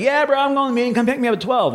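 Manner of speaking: high, breezy mock agreement; overly cheerful yes, sung up high; fake eager. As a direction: sell a promise he won't keep. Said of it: "I'd be like".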